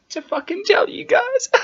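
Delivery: squeaky, laughing and loud; laughing squeal. Real, not acted. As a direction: break into a high laughing squeal. Proud announcement, laughing.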